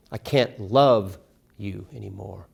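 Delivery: slow down and lean on "love"; stress on LOVE. Shown as a demonstration. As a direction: hold the word "love", let the rest fall away. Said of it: "Emphasize individual words in the title".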